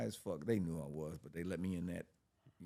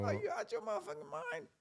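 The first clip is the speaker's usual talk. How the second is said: way higher, outraged disbelief; incredulous. Retold or imitated. Telling girlfriend off, much higher outraged disbelief. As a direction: squeal out the disbelief. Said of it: "I said".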